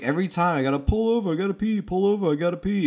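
high, fast, loud griping; worked-up retold complaint; mock exasperation. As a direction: rattle off an indignant imitation. Acting whiny complaint from film.

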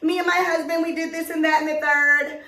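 high, sugary bragging voice; a hint of a syrupy show-off wife; mocking a gushing wife. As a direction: gush about your husband like a show-off. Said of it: "this is how they talk".

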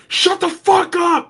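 high, drawn-out imitation of an angry yell; mock yell. Brother turns round to silence the impression, mock yell. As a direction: yell it in pretend exasperation, without full volume. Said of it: "Weren't you just yelling at them".

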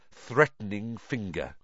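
plain reading voice

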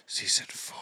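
hushed, voiceless whisper; whispered aside. Pretend. Co-host naming the new season to her friend, whispered. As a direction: whisper it under the other talk.

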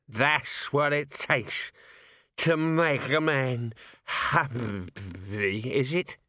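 put-on very slow slurred drunk; glassy-eyed drawl; drunk Nobby. A narrator's reading. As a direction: drunk man challenging the drinkers, slow and slurred.

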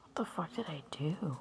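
whispered dismay. Real, not acted. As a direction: whisper it, stunned. Quiet shock at broken nail.

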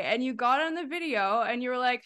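ordinary talk

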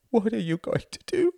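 very high, anguished wailing question; mother's wail. A narrator's reading. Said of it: "wailed his mother".

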